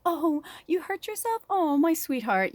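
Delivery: put-on high, cooing comfort for a hurt child; soothing a child's scrape, doting and high; doting mother voice. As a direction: coo like a fussing nurturer. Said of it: "the feminine, it's like".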